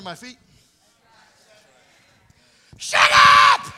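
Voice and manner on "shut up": high, loud rebuke; shout at devil. Acted. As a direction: silence the devil with one blast.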